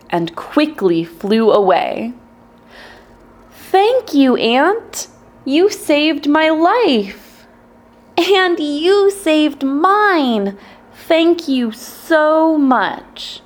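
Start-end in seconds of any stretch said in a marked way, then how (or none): 3.5-7.5 grateful bird. Acted. Bird thanks the ant. 8.2-13.4 grateful tiny ant. Acted. Ant thanks the bird.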